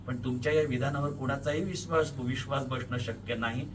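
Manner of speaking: ordinary delivery, nothing marked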